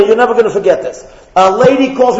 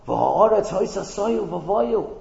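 slow verse recital; 3 semitones lower, 10 dB quieter, much slower than usual. Acted. Chanting the Hebrew verse.

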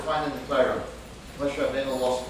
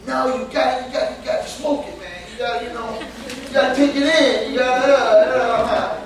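higher mock stoner voice, stretching the end; a teacher mimicking a student who says you must smoke it, in a higher mock voice; imitating a stoner; 7 semitones higher, 5 dB louder than usual. Teacher mimics student urging her to smoke, mock stoner voice. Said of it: "he was trying to tell me".

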